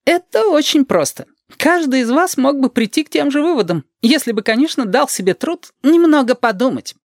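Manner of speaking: high-pitched condescending schoolmistress; creaky lecturing voice. Acted. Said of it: "говорит своим скрипучим голосом, таким тоном".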